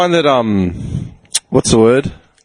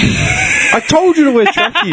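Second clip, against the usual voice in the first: high, slow, laughing through the words; slower than usual. Real, not acted. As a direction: tease while laughing. Teasing friend, laughing.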